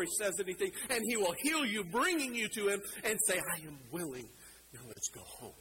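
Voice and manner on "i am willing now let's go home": soft, low, tender invitation; gentle reassurance. Acted. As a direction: drop down quietly to welcome them in. Jesus gently welcomes the sinner.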